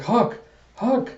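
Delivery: high, lightly voiced girl calling a name; a small girl calling for help.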